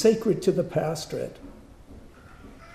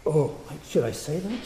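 ordinary delivery

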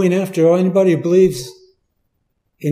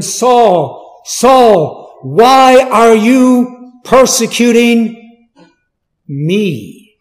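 loud, high call of Saul, Saul, slow on why; booming divine call; calling voice of heaven; 5 semitones higher, 6 dB louder, much slower than usual. Acted. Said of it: "Personal pronoun".

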